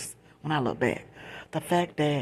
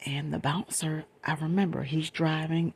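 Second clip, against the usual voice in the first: ordinary delivery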